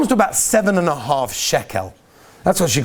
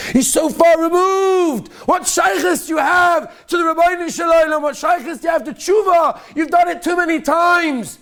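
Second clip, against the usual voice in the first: Yetzer Hara voice; 13 semitones higher than usual. Acted. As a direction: taunt the listener like the evil inclination. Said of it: "the Yetzer Hara ... says to us".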